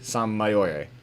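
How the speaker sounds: Mayor said the French way, low and soft; French accent. Pretend. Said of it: "Je ne parle pas français".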